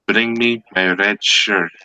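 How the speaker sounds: slow, deliberate command; measured captain giving an order; solemn captain's order. Acted. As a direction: draw the order out slowly. Joke captain gives an order.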